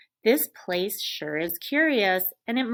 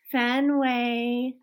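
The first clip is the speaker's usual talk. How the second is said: a light hint of a hopeful girl calling; 4 semitones higher, 4 dB louder than usual. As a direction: call the dog's name with mild hope. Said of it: "she calls in a hopeful voice".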